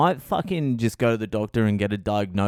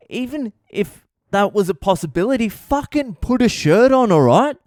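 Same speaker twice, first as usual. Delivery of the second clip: much higher, louder exasperated scold; scolding wife; 10 semitones higher, 5 dB louder than usual. Retold or imitated. Wife scolding husband to put a shirt on, high and loud. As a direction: lay into him like a fed-up wife.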